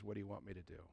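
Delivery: very low, hushed question; humbled repentance. Acted. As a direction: drop right down, meek and quiet. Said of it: "he falls on his face and he repents".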